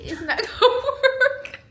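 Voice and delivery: high, loud laugh through the words. Real, not acted. Wife laughing as the bag won't fit, high laughing. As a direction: laugh it out at the tiny pocket.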